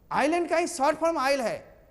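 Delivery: ordinary talk, nothing marked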